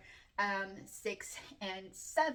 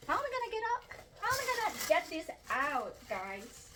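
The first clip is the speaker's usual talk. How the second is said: anxious; 6 semitones higher, faster than usual. Real, not acted. Struggling to lift it out.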